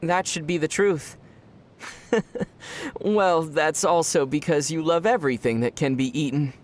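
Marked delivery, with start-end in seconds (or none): 2.1-2.9 amused laugh. Real, not acted. Amused at Paimon's food love.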